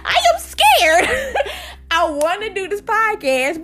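high squeal through laughter; hammed-up scared plea, cracking up; laughing protest. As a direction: giggle while pleading fear. Laughing while pleading she is scared.

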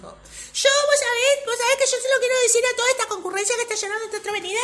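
high, rushed, loud proclamation; Bradford. Acted. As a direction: address the whole theatre in one breathless run. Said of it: "Bradford dijo".

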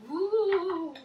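rising laughing ghost woo; a playful spooky ghost cry; ghostly wail. Pretend. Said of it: "It's a ghost sound".

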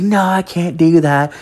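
a hint of a stubborn young woman; imitating girlfriend's friend. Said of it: "she's like".

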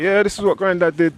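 a hint of a fond grandfather; proud granddad. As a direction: show off your old records proudly. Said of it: "Play it to the grandkids".

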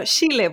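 "Chile" with sh, spoken inward; Chilean sh sound. Shown as a demonstration. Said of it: "el sonido como de Chile".

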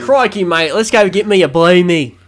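loud, high, hammed-up Aussie accent; mock Australian accent. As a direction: belt it out as a cartoon Australian. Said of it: "Australians trying to pronounce some word".

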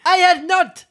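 offended mouse crying out at Alice; angry mouse. Acted. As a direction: cry it out offended. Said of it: "cried the mouse angrily".

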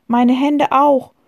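put-on a touch of sobbing girl; girl showing her scraped hands, tearful. A narrator's reading.

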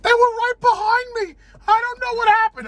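high, loud squeaky little ant panicking; panicked ant voice. Acted. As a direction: squeal it like a frantic tiny ant. Ants voiced after being sucked in.

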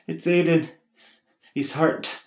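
loud, high, breathless news; urgent alarm. A narrator's reading. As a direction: press the alarm on her. Neighbour bringing urgent news.

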